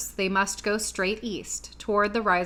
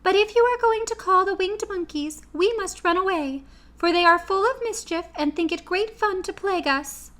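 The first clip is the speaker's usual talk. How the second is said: much higher, tiny mouse queen voice; Queen of the Mice to her friends, squeaky and kind; squeaky little voice; 10 semitones higher than usual. A narrator's reading.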